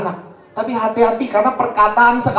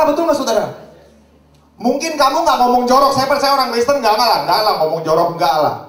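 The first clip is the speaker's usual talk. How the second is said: ordinary talk, nothing marked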